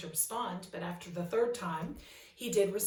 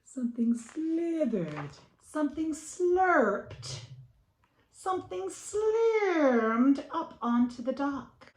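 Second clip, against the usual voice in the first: higher, louder, stretched each word; slow rising spooky; 9 semitones higher, 7 dB louder, much slower than usual. A narrator's reading. Reading to the children, a slow rising creepy build. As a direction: creep it up slowly.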